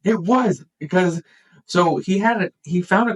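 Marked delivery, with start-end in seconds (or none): none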